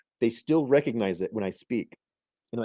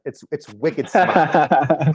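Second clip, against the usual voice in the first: louder, slow imitation of an Eastern accent; mock Eastern accent; 4 semitones higher, 6 dB louder, much slower than usual. Teasing Derek about his speech, mock Eastern accent. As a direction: imitate New England talk, laughing. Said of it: "I like Derek's Eastern accent".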